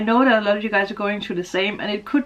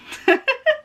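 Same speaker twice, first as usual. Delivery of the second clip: a short high laugh that rises; light emotional laugh. Real, not acted. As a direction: laugh at yourself, moved. Laughs after reading lyrics.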